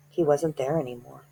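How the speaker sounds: ordinary delivery, nothing marked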